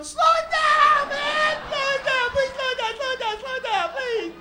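put-on high, drawn-out kid screams; panicked pleading. Skinny kids begging on a spinning merry-go-round, panicked pleading. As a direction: shriek and beg over and over, very high and loud.

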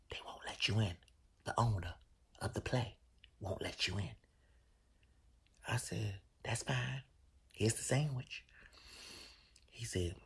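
soft, slow, muted whisper; hushed whisper. Retold or imitated. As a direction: deliver bad news under your breath. Assistant whispering bad news.

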